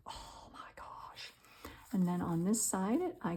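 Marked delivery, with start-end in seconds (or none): 0.1-1.3 hushed, breathless oh my gosh; soft whisper of wonder; awed whisper. Real, not acted. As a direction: whisper it, overcome by the stamp. Admiring the stamp, hushed aside.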